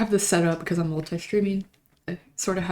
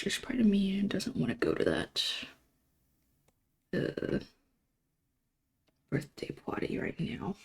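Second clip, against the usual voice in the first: soft menacing villain whisper, much quieter; Green Goblin voice; 9 dB quieter than usual. Shown as a demonstration. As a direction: whisper it like a sulky comic villain. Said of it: "Green Goblin voice".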